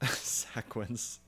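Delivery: "sequence" as SACK-wence with a laugh; jokey mispronunciation. Shown as a demonstration. Streamer joking about the suggested pronunciation, "sequence" said SACK-wence. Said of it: "rather pronounced like sack than seek".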